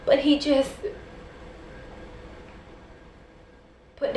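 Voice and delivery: dramatic spoken-word recitation with measured pauses; spoken-word poem. Acted. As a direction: perform it as a rhymed slam poem, line by line.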